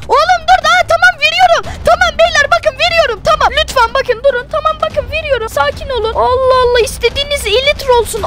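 high, hurried begging to calm them; frightened man begging the gang to back off; panicked pleading. Acted. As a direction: plead for your life, giving in. Handing over elytra under threat.

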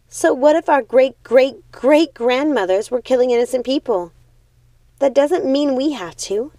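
high, loud desperate plea; pleading sister. Acted. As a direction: beg her to run away. Primrose pleads to leave.

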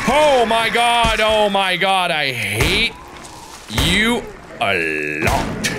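high, slowed, breathless oh my God; real shaken relief. Real, not acted. Gamer recovering from a fright, still high and shaken. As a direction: gasp it out after the scare. Aftermath of scare.

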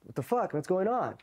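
high-pitched imitated confusion; retold baffled challenge. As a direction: act out being caught off guard. Confrontation with the MPs, retold.

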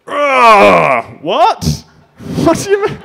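loud monster roar into high squeaked disbelief; roar, then question it in baffled outrage; incredulous Hulk. Acted. Disbelieving monster to audience, incredulous roar. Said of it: "This is the incredulous Hulk".

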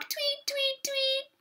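very high, soft birdlike squeak; teasing tweet. A narrator's reading. Brother teases dancing sister, squeaky tweeting. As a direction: chirp it in a tiny falsetto to tease. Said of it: "he teased".

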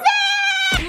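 high shouted listen. Real, not acted. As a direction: yell to get attention. Calling him to order.

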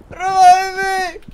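high, slow drawn-out plea; pleading shout. Acted. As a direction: beg loudly for a revive. Downed in game, pleading.

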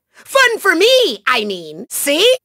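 ordinary delivery

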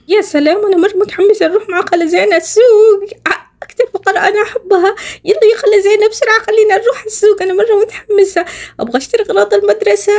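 put-on high, eager child voice; excited little girl. Niece tells aunt Zeina she's eager to shop, high and excited. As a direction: bounce with a kid's excitement.

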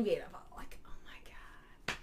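breathless whisper of adoration; swooning whisper. Acted. Swooning to her friend about the celebrity crush in a whisper. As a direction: whisper it like she's melting.

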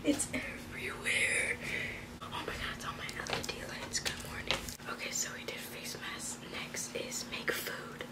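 hushed late-night whisper. Real, not acted. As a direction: whisper so no one wakes. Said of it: "I have to be quiet".

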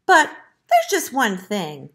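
lightly played reluctant piglet; sighing piglet. A narrator's reading. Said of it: "sighed the piglet".

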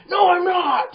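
high, loud, angry scream; full shouted protest from a provoked passenger; exploding denial. Acted. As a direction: blow up at the false accusation.

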